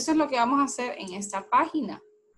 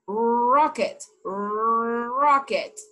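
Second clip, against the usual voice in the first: slow mouth demo; much slower than usual. Shown as a demonstration. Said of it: "Miren mi boca".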